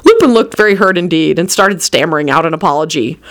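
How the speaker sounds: ordinary delivery, nothing marked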